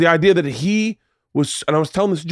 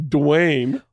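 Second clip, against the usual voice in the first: mocking the name. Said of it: "What a weird name".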